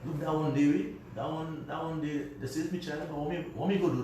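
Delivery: put-on Vincy father's accent, shrugging it off. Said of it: "in his Vincy accent".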